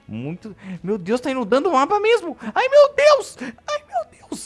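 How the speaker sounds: shrieking very high and loud in panic; panicked shriek. Real, not acted. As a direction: scream it out, the flood is coming. Flood panic in game. (grito de pânico agudo)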